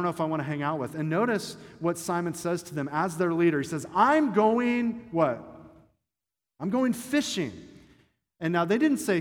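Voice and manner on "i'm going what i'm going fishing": loud, high declaration of going fishing; mock-defiant Peter, louder and higher; Peter's defiant announcement. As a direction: belt it out like a stubborn leader.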